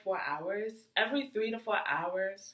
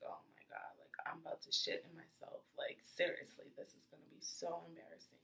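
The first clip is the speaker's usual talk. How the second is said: hushed, embarrassed whisper to herself; panicked inner whisper; 2 semitones lower, 10 dB quieter than usual. Retold or imitated. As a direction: whisper it like no one may hear. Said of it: "And I'm just like".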